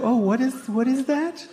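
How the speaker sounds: high, puzzled mock astonishment; mock surprise. Host meeting a vegan, in high mock astonishment. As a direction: pitch up, baffled by the strange thing.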